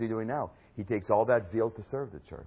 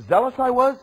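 fervent boast; 11 semitones higher, 10 dB louder than usual. Acted. Boasting of his zeal.

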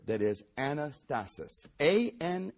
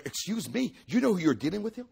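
high, loud, fast mock indignation; indignant Jesus; 9 semitones higher, 7 dB louder, faster than usual. Jesus retorting to the doubting father, indignant and loud. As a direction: bristle as if insulted.

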